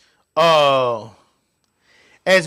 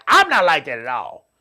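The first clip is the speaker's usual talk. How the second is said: normal talking voice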